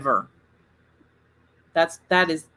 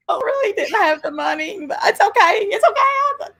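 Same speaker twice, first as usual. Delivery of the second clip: tearful; 13 semitones higher than usual. Real, not acted. Tearful recalling money trouble.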